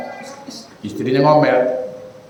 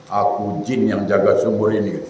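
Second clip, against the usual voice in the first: low, soft eerie jinn from the well; 5 semitones lower, 5 dB quieter than usual. Acted. Jinn answers the husband from the well, low and eerie. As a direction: speak as the well's jinn, low and hushed.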